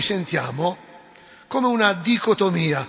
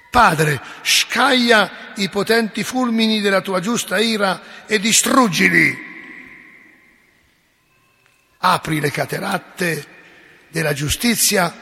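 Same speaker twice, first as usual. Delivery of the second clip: vengeful prayer; 4 dB louder than usual. Pretend. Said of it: "poteva essere così".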